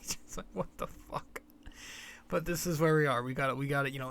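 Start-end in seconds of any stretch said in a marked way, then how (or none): 0.0-1.4 laughing whisper. Retold or imitated. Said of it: "I was just cracking up at it".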